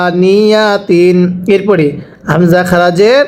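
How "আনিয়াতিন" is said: teacher's slow model reading of আনিয়াতিন; slow drawn-out reading. Shown as a demonstration. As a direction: stretch আনিয়াতিন out very slowly, each syllable held. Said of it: "আরো একবার পড়ব".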